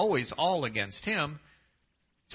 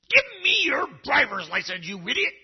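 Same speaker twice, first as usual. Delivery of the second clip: high, loud hammed-up yell at a driver; mock angry cop; 11 semitones higher, 5 dB louder than usual. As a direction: bark it like a furious traffic cop. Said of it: "yelling at you?".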